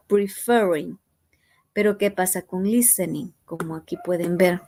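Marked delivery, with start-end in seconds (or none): none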